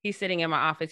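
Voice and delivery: usual speaking voice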